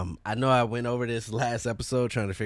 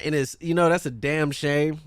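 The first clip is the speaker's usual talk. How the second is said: ordinary talk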